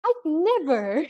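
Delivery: high, loud, drawn-out mock outrage; scandalized elf. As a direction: gasp it out as an affronted elf, hammed-up. Scandalized elf woman answers crude question.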